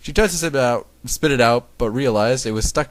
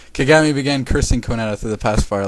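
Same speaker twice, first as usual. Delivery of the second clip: ordinary delivery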